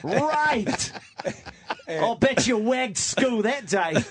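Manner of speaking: hammed-up Australian accent, a bit high; mock Australian accent. Host mocks the Aussie host in an Australian accent. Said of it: "You did the Australian".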